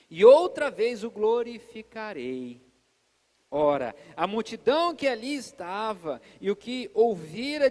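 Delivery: slow, loud preacher's Bible reading; solemn scripture reading. Acted. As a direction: proclaim the verses slowly and loudly. Reads scripture aloud solemnly. (leitura bíblica solene, lenta e forte)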